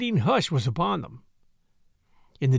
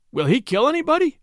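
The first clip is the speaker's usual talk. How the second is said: higher, louder, urgent repeat of the question; anxious repeat; 8 semitones higher, 4 dB louder than usual. A narrator's reading. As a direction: ask again higher, with rising worry. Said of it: "he said again".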